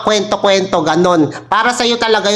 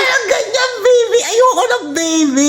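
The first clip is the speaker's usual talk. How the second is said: whiny fake crying. Embarrassed whining refusal.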